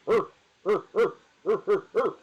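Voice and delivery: high, loud grunting dog whines; dog whine imitation. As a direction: imitate a pleading dog's urgh. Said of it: "I get that".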